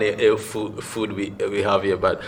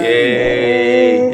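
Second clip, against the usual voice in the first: cheer; 6 dB louder than usual. Acted. Cheering the guest.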